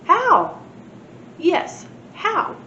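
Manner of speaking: lightly voiced children asking how; kids demanding. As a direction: ask like a curious little kid.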